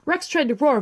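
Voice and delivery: ordinary delivery, nothing marked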